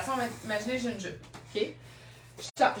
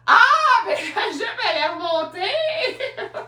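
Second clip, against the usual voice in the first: very high exclamation breaking into laughter; hammed-up high outcry; mock horrified realisation; 9 semitones higher, 7 dB louder, slower than usual. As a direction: shriek it in playful embarrassment, stretching the end. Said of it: "Je fais comme".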